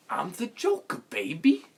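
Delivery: high, loud amateur acting; hammed-up school stage delivery; high school play. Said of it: "like, high school play".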